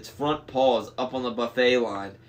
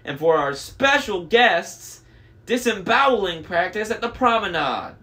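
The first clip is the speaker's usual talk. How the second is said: high, upbeat intercom voice; chirpy cruise announcer; cheerful announcer; 6 semitones higher, 5 dB louder than usual. Acted. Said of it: "sounded way too happy".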